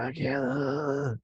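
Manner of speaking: thin, slow, winded plea; struggling to breathe. Retold or imitated. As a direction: squeeze the words out like a hurt cat. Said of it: "He said I was sounding like a cat".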